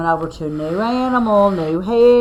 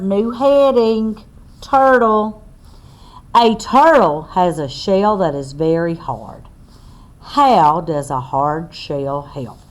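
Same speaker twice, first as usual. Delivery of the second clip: slow, loud read-aloud to a class; reading to children; 4 semitones higher, 7 dB louder than usual. Acted. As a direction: spell it out clearly for little listeners. Reads book text aloud.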